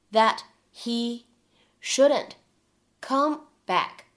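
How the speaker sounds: slow, broken into short chunks with long pauses; word-by-word demo. Shown as a demonstration. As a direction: read it out chunk by chunk for learners. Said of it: "我们来看一下以下的这样一些发音技巧". (逐词慢速示范朗读)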